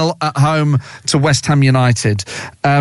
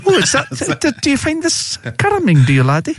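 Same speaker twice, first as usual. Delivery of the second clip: much higher mock Scottish old lady; higher-pitched Scottish grandma teasing her laddie; Scottish granny voice; 10 semitones higher than usual. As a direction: tease your co-host as a Highland granny. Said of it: "super grannish".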